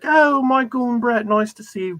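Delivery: high, put-on British accent imitating Jeremy; a self-mocking bad British accent; lightly hammed British accent for a friendly greeting. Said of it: "Pardon the bad accent".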